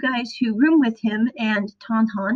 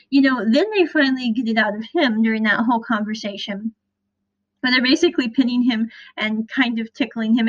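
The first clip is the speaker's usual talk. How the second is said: usual speaking voice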